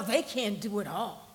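normal talking voice

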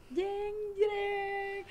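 sung-out high "dun dun" reveal; high, held mock-suspense jingle; dramatic sting. Shown as a demonstration. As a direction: mimic a soap-opera music sting, drawn out. Mimics dramatic reveal music sting.

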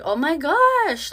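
high, loud, slow gush; gushing woman. Retold or imitated. Woman in the elevator complimenting her, excited gush. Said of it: "this woman was like".

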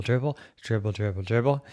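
usual speaking voice

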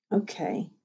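very soft, sleepy murmur. Acted. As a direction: murmur it half asleep. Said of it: "she murmured".

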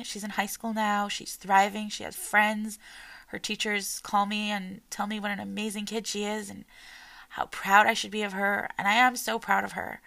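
ordinary talk

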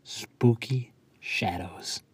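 slow, drawn-out whisper. Acted. Poet closing his poem for the audience, in a slow whisper. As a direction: let the last words fade into a whisper.

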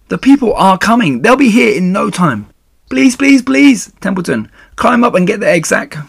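high, loud, frantic urging; desperate pleading. Acted. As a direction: beg as time runs out. Wilbur begs the rat to hurry.

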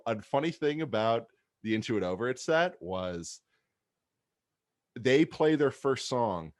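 usual speaking voice

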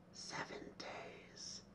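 put-on eerie whispered little girl; creepy child. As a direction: breathe it slowly like a ghost child. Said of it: "a creepy little girl's voice saying".

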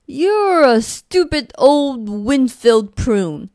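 slow, loud, drawn-out insult; first monster roaring names over the mountain; shouted insult. Acted. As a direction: stretch the insult out as a yell. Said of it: "shouted the first monster".

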